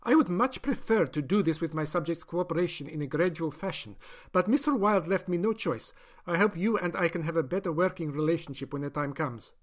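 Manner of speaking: a doctor justifying her experiment; doctor's voice. Acted. Doctor coldly explains to captive.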